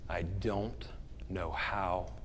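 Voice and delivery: low, hushed, halting admission; quiet confession. Retold or imitated. Man who had an affair confesses, quiet and low. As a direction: confess it slowly under your breath, voice sinking. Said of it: "you will hear this".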